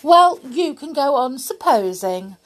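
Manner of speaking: cheeky doll snubbing the toys; rudely. Acted. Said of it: "said Amelia Jane rudely".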